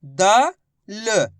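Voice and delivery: द and ल as separate short syllables; each letter on its own, no long aa. Shown as a demonstration. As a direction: sound out द, pause, ल. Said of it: "इस तरीके से पढ़ते हैं".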